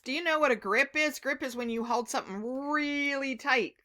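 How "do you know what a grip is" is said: plain reading voice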